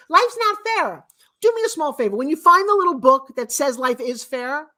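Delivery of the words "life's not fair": high, louder mock of a cliché; mock the people who say it; mocking quote. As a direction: sneer it as a worn-out saying. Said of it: "I love when people say".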